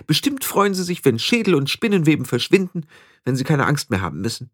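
put-on high-pitched, self-assured young witch; conceited young witch, eager. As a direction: chatter on in a high, vain voice. (eingebildete junge Hexe, hohe Stimme)

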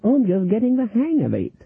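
put-on very high, stammering old lady; Granny fakes wonder at winning; mock-delighted granny. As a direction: gush like a naive beginner. Granny innocent.

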